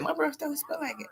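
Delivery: high, soft delighted marvel; high hushed delight; amazed. Real, not acted. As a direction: savour the surprise softly. Marvelling at her breath.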